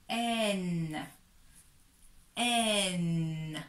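"n" said as a long "enn"; syllable demo. Shown as a demonstration. Teacher shows e and n together, drawn out. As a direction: hold the vowel and hum the n. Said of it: "Donc ça fait".

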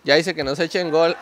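usual speaking voice

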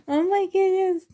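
high, loud delighted exclaim; overjoyed cry at a gift; thrilled. Real, not acted. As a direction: gasp with real delight.

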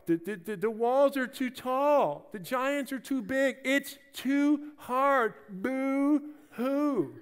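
high whiny mock crybaby; whiny boys. The fearful Israelites balking, high whiny complaint. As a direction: whine like scared little boys. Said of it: "The boys, they balk".

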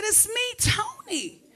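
high, soft put-on feminine voice; mock girly high voice. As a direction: sweeten it up so he doesn't recognize you. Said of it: "why your voice sound like that".

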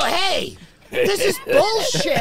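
loud, high retold shout; hammed-up indignation, slow and loud; outraged complaint. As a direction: holler it like a cheated customer. Acts out outrage at the pharmacy.

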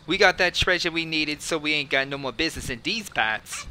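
put-on tough-guy New Jersey accent. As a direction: read it like a Jersey mobster. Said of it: "I should say it in, like, in a J-, New Jersey accent".

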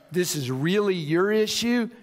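mildly patronizing, put-on tone; condescending. Apologizer proving they are the bigger person, condescending. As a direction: talk down to them, lightly acted. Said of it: "you are being condescending".

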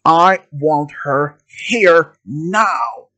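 usual speaking voice

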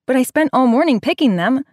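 put-on protesting boy, hurt; whiny boy voice. A narrator's reading.

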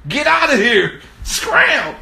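high, loud comic yell; shooing order. Acted. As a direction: chase someone off sharply. Ordering himself to leave.

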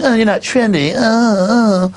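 high, put-on disapproving whine; hammed-up snooty trendy with drawn-out uhs; mock trendy. Trendy shaming a customer who refuses, high mocking voice. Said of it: "And if you're like".

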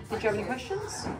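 usual speaking voice

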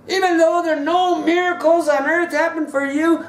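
preaching. Acted. Preaching at full force.

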